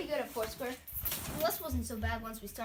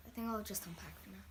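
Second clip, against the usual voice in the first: whispering; 5 semitones lower, 7 dB quieter than usual. Acted. Quiet new kid withdrawing.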